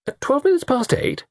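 very high incredulous question; astonished echo of the time. Acted. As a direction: query it in disbelief, pitch leaping up. Harry disbelieves the butler's answer.

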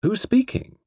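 a hint of a startled author; lightly voiced Eastwood. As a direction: ask warily who it is. Eastwood on the phone.

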